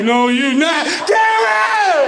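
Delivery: high, cracking call for help; panicked plea. Retold or imitated. Son calling out to dad in panic, high shriek. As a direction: shriek for the other parent. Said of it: "I was like".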